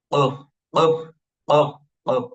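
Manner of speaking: কফ with a hard throaty push, loud; pushed qaf. Shown as a demonstration. Teacher drilling the qaf sound for students, loud and pushed. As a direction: force কফ out from the back of the throat. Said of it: "এরকম ধাক্কা দিয়ে উচ্চারণ হবে".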